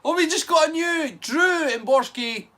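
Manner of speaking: high, loud celebratory announcement; excited announcement. Real, not acted. Host announcing a new member, excited announcement.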